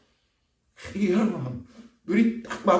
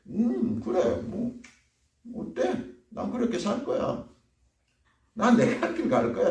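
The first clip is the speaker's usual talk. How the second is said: flippant high defiant voice, brushing off the warning; defiant sinner; 8 semitones higher, slower than usual. Acted. Preacher playing a sinner who shrugs off God's warning, cocky.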